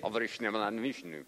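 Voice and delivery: mock-Russian gibberish in a monotone. Preacher mimicking his translator's Russian, serious monotone. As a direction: imitate a very serious, deadpan translator. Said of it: "monotone in the way that he spoke".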